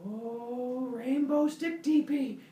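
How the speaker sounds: high, slow dopey wonder; awed delight. Acted. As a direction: asombro lento y agudo de humano simple. Dopey humans marvel.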